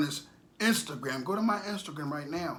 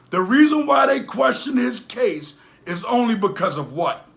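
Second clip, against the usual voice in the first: indignant shout; 5 semitones higher, 11 dB louder than usual. Real, not acted. Building indignant rant.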